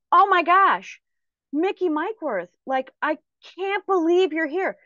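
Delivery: high-pitched, imitated gushing disbelief; amazed recognition. Stranger spotting the speaker at the soda machine, imitated amazement. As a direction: act out a thrilled stranger recognizing someone.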